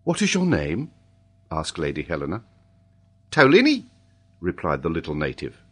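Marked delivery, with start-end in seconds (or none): 0.0-0.9 high lady's voice asking; kindly lady. A narrator's reading. Lady Helena questions the child. 3.3-3.8 put-on high, loud little boy; Tolini. A narrator's reading. Child gives his name.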